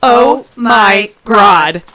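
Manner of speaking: very loud, slow oh my God; stunned outburst at the sight; drawn-out horrified exclamation; shocked loud gasp. Acted. Sees the naked human.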